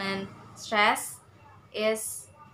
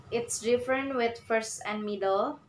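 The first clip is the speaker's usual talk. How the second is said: ordinary delivery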